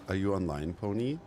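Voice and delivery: normal talking voice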